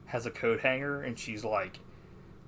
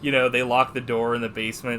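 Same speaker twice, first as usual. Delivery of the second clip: ordinary delivery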